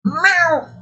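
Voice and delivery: tiny high meow back at the cat; playful cat imitation; mock cat meow. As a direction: answer the cat with a squeaky little meow.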